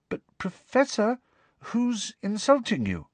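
hesitant student asking; timid. A narrator's reading. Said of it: "asked Parvati timidly".

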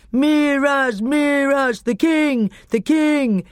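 loud chanting roar of a crowd; loud, high crowd chant for their king. A narrator's reading. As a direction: roar it out as the cheering army. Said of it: "came the roar of the Telmarines".

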